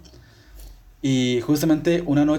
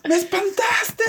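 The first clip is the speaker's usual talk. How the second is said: very high startled shriek; imitating mom shrieking; much slower than usual. Mother shrieking at her son who scared her, high shriek. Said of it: "mi mamá gritándome así como de".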